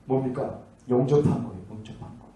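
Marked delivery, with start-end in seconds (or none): 1.7-2.3 breathy whispered echo. Real, not acted. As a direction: let it fall to a whisper. Whispered echo of previous line. (속삭이듯 되뇌기)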